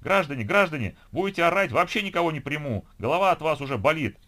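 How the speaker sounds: loud, strained bark at an unruly crowd; fed-up official shouting people down; hoarse shouting. A narrator's reading. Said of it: "хриплым баском закричала регистраторша".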